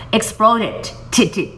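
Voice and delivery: Exploited said very slowly, then T spelt letter by letter; careful slow spelling demo. Shown as a demonstration. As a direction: spell the word out slowly for students. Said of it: "加那个E D，exploited".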